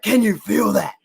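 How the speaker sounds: loud, high-pitched hype-up shout; Brian Dawkins. Retold or imitated. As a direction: roar it like a player firing up the team. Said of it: "when he's like".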